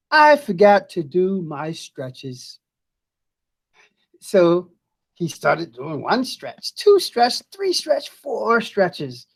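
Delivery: lively storybook voice with big rises; reading to children. Acted. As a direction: read it like a picture book to kids. Teacher reads picture book aloud.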